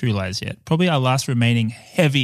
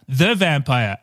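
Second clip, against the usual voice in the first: ordinary talk, nothing marked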